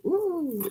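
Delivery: high, delighted ooh; self-satisfied high ooh; pleased ooh. Real, not acted. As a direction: coo at your own accent. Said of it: "like my, my Italian accent".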